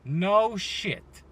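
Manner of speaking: ordinary talk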